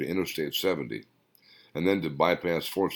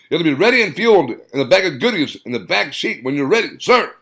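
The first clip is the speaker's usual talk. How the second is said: eager sergeant; 8 semitones higher, 7 dB louder than usual. Acted.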